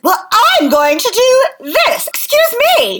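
put-on bratty child. Host mocking an obnoxious, rude cartoon child, bratty child voice.

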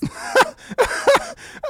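loud squealing laughter; cracking up hard; high shrieking laugh. Real, not acted. Laughing at punchline.

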